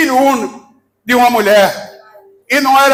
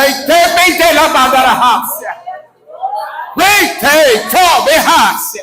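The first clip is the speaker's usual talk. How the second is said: fiery preaching. Acted. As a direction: preach it full force to the crowd. Preacher fires up congregation.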